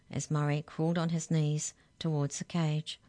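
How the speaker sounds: usual speaking voice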